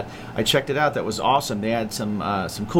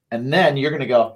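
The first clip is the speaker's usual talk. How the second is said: ordinary delivery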